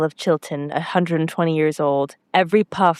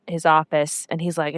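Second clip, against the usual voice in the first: ordinary talk, nothing marked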